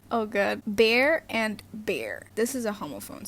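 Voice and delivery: usual speaking voice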